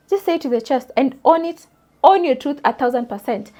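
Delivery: usual speaking voice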